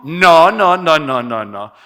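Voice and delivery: loud repeated no, cutting the idea off; emphatic loud no. Retold or imitated. Speaker mocking the stubborn sister with a loud no. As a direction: slam the door on it with a loud no after no.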